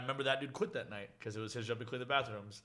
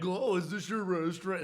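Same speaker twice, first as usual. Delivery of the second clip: higher, lightly voiced eager asker; mock curious customer; 7 semitones higher, 4 dB louder than usual. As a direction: ask it like a gullible diner. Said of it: "it was kinda like".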